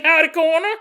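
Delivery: high-pitched, hammed-up country sheriff accent; sheriff's accent. Sheriff visiting the coroner, comically high country accent. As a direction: greet folks in a silly high rural twang accent. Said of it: "The sheriff walks in".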